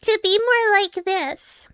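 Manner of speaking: put-on very high, drawn-out anime girl voice; exaggerated anime voice. Shown as a demonstration. Showing how anime exaggerates the voice, very high. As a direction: push it up high and slow, anime-style. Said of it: "anime would, uh, exaggerate that more".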